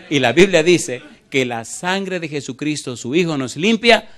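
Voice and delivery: full-force gospel preaching; fervent proclamation. Real, not acted. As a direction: lift the church to belief. Preacher proclaims cleansing blood.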